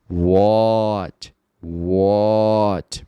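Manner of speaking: What said with an aw sound, wot; o-like vowel. Shown as a demonstration. Teacher showing the o-like vowel in What. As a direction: round the a toward o. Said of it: "mais o do que a".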